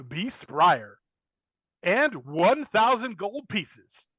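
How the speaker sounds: high, hyped sponsor announcer; Chad announcer voice. Acted. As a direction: sell it like a cheesy ad read. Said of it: "you hear Chad go".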